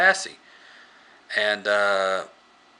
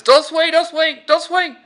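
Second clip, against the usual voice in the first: much higher and louder panicked shouting; frantic yell; 7 dB louder than usual. Retold or imitated. Columbus warning Tallahassee off during the chase, panicked yell. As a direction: yell it fast in panic as the zombie closes.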